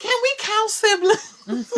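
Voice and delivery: high squeaky mock plea; tiny teasing voice, slow; mock whiny question.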